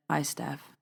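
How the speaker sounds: lightly voiced grieving father, subdued; solemn. The father answering the phone, solemn and soft. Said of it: "a very solemn voice".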